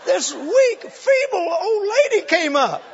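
high, loud and slow, laughing through tears; cry-laugh it out. Real, not acted. Teller breaking up describing the feeble old lady, laughing cry.

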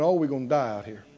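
low, quick mock lament; mock crying wail. Spies whining to Israel, a mock crying wail. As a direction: whine it like a frightened crowd. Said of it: "started crying and going".

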